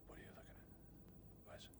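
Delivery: hushed action hero whisper; voiceless, secretive whisper into a sensitive microphone; stage whisper. Shown as a demonstration. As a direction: whisper as if nobody should hear you. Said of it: "tell me how to whisper loudly".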